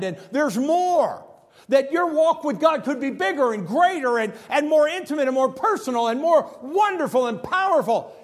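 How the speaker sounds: rising fervent sermon climax; impassioned preaching. Acted.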